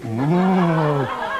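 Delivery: ordinary talk, nothing marked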